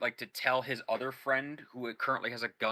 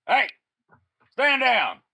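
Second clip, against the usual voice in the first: high, loud yell "stand down"; shouted order; 11 semitones higher, 9 dB louder, slower than usual. Acted. Boss yelling to his sniper, loud and high. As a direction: bark it across to the sniper. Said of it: "turns around and goes".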